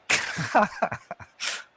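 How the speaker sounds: high burst of laughter; real cackle. Real, not acted. Laughs at chat banter.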